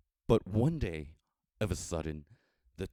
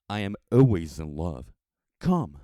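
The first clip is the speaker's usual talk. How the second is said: loud, fervent declaration of love; ardent young man; 9 dB louder than usual. A narrator's reading. The young man declaring his love to the traveler, loud and fervent. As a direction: proclaim it like a lovesick youth.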